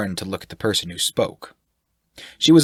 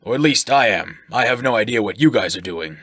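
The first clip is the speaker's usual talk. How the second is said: loud, higher, hearty; jovial sailor-type voice; 3 semitones higher, 5 dB louder than usual. Acted. Shirk flatters the sailor, loud and jovial. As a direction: boom it out like a jolly brute.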